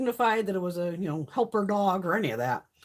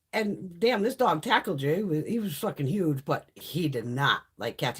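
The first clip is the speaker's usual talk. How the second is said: ordinary talk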